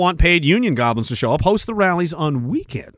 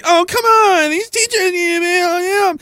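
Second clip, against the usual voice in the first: much higher mocking whine; mock whiny voice; 13 semitones higher, slower than usual. Mocking people who defended the teachers, much higher. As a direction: whine like a scolding critic. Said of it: "people were like".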